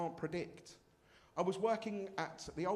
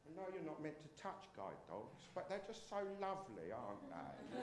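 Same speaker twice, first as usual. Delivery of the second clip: cooing woman; 10 dB quieter than usual. Retold or imitated. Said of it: "she was doing this".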